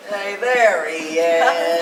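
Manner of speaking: slow, loud jeering call; loud heckle. Acted. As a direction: heckle the groom across the room. Wedding guest heckling groom.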